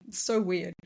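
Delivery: normal talking voice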